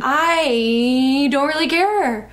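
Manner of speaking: hammed-up sneer with a long stretched "I"; dragged I, rushed rest. Shown as a demonstration. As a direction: drag out "I" very long, then speed through "don't really care". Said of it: "dragging the I and speeding up on the".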